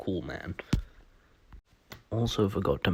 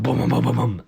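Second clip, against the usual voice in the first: loud mock explosions, a little higher; missile booms; 3 semitones higher, 8 dB louder, faster than usual. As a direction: shout the booms like a kid playing war. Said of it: "he's going like".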